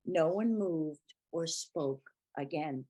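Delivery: ordinary delivery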